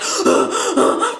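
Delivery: sharp panicky gasps; acted horrified gasping; shocked gasp. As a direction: gasp as if freaking out. Acting out shock at death.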